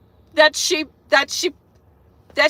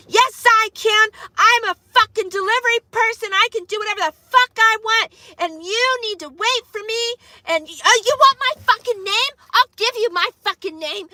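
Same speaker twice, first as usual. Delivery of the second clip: imitating an angry carrier; 8 semitones higher than usual. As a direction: play the furious delivery woman yelling back. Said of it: "bitching me out".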